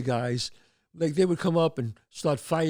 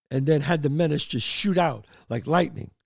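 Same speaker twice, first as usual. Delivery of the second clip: ordinary talk, nothing marked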